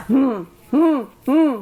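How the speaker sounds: loud, short husband's "hmm" grunts; imitated curt grunts. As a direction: grunt back like an unresponsive husband. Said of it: "jawabannya cuma gini".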